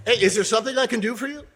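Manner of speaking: loud, high and quick, calling out to help; bright, loud offer of help; loud friendly offer. Shown as a demonstration. Coach calls out a loud offer to the fallen. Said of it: "That's a little loud".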